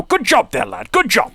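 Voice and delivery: high-pitched, goofy cartoon dad congratulating a lad; hammed-up accent, running away into silly repetition; Jimmy Neutron's dad. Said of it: "whatever, uh, Jimmy Neutron's dad says".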